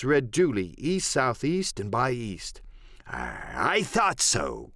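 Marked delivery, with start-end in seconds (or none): 3.1-4.7 very low, slow, drawn-out pirate voice; Silver, knowing pirate cook. Acted. Silver seeing his hunch confirmed, low slow pirate voice. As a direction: drop deep and draw it out.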